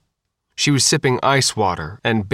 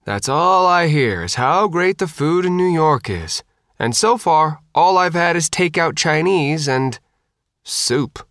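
higher-pitched complaining patient; griping Faith; 6 semitones higher than usual. A narrator's reading. Patient griping about takeout and soup, disgruntled. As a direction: pitch it up and grumble, dropping for the final word.

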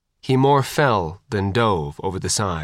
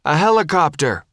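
higher, raised calling voice; shouted back; 5 semitones higher, 3 dB louder than usual. A narrator's reading. Hooch answers Faith's boarding question, shouting back. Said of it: "Hooch shouted back".

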